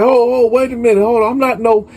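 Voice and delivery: high, hurried objection; retold alarmed protest. Accused priest protesting to K B in a retold quarrel, alarmed.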